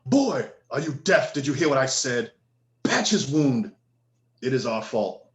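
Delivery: put-on father scolds the boy, angry and impatient; scolding father. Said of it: "the man talking to you says".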